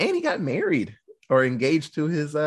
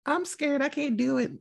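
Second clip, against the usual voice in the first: put-on scared little girl; 9 semitones higher, 4 dB quieter than usual. Scared daughter told to shoot.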